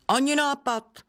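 high, scornful refusal; sneering. A narrator's reading. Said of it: "ozvala se pětiprstka a ušklíbla se". (úšklebně, vysokým hlasem)

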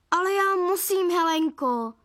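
high, pleading mild wail. A narrator's reading. Dad begging wife for cake, wailing. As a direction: plead in a whine that sinks on the name. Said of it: "zakvílel táta".

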